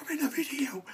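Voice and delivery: high, whispered mock thrill; hushed, hammed-up disbelief; excited whisper. Mock thrilled reaction to being featured.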